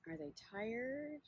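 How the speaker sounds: unhurried meditation guide, long pauses between phrases; guided body scan. Acted. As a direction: guide listeners gently inward, leaving space after each question.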